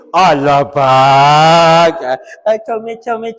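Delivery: loud, drawn-out cooing at a sulking husband; hammed-up sweet talk, slow on comel; mock coaxing wife. (isteri memujuk suami dengan manja dan kuat)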